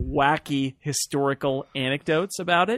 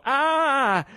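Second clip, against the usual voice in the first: surprised gasp; 11 semitones higher, 3 dB louder than usual. Retold or imitated. Retold reaction to melting spoon.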